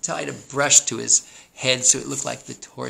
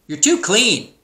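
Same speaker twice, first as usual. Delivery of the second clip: high, loud sheep verdict; blunt sheep; 9 semitones higher, 10 dB louder, slower than usual. A narrator's reading. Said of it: "said Sheep".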